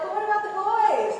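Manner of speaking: imagined student objection. Acted. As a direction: pipe up with a teasing, high-pitched objection. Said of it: "But you're like".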